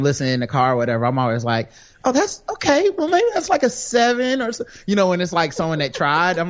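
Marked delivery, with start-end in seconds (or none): 2.0-4.6 high-pitched, lightly mimicked verdict; acting out an easy judge, pitched way up; generous reaction. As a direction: lift the voice high for a kindly guess. Quotes own generous scoring reaction.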